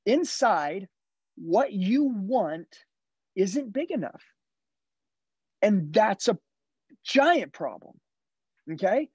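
a coach rallying his car salesmen, punching the key words; motivational sales pep talk. Acted. Sales coach urging the team to dream bigger, driving and emphatic.

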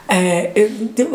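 normal talking voice

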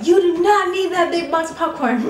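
high, loud scolding mother voice; scolding mom. Retold or imitated. Imitating her mom scolding her over the popcorn box, high and loud. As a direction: play the disapproving mom. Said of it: "my mom is gonna be like".

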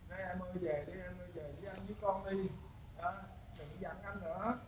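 soft pleading. Retold or imitated. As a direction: read his own plea quietly, almost pleading. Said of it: "đăng nói như vậy đó".

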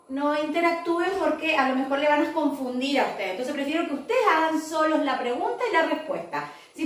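ordinary talk, nothing marked

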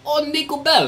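high, loud mock Russian accent; Nico. Gamer copying GTA character, high Russian accent. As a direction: call out in a fake Russian accent. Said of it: "think about it like Nico".

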